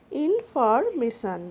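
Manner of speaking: Information stretched slowly, every syllable clear; slow model pronunciation. Shown as a demonstration. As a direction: spell it out slowly for the class. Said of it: "ইংরেজি উচ্চারণ হলো". (ধীরে স্পষ্ট উচ্চারণ)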